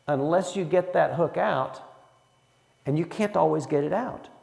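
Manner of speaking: ordinary talk, nothing marked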